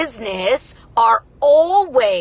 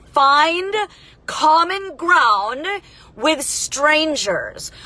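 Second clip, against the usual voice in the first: Find pitched up, then common ground with strangers very slow; slow emphatic repeat; 5 semitones higher, much slower than usual. Acted. Key lesson repeated.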